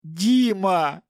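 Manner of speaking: high, loud distressed cry; tearful. A narrator's reading. Captive wife calls to her husband, tearful. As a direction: call the husband through tears. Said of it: "сквозь слёзы обратилась она".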